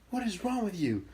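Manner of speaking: high-pitched scolding imitation; imitated mom. Mom confronting dad back home, high-pitched scolding. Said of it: "my mom goes".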